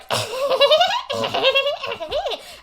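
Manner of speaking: high, loud imitated cackling; hammed-up crazy villain laugh; maniacal cackle. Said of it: "Cackling like a fucking maniac".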